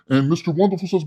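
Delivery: usual speaking voice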